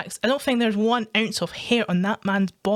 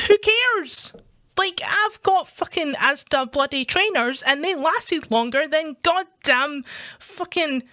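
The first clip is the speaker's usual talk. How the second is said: worked up and higher-pitched, ranting; indignant rant; 5 semitones higher than usual. Real, not acted. Ranting to listeners about being mocked for Asda clothes, indignant. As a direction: rant in raised, exasperated pitch. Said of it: "I'm in a bit of a rant now".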